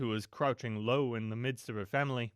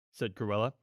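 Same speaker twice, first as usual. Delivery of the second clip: plain reading voice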